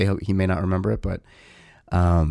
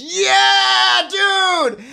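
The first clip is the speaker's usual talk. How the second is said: a much higher, louder victory yell; retold scream; 6 dB louder than usual. Dad screaming as son finally beats the level, loud triumphant yell. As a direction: throw your hands up and scream in triumph. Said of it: "And I scream".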